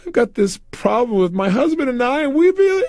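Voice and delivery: high, tearful imitation of a distressed wife; mock upset wife about to cry; imitated crying woman. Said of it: "she began to cry like crazy".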